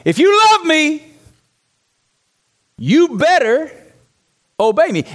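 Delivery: high, forceful LOVE, then a pointed BETTER before "obey me"; demanding verse. Retold or imitated. Preacher showing how he always heard the verse, demanding. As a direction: lay it down as an ultimatum, pausing before "you better" and "obey me". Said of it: "Here's the way I heard it most of my life".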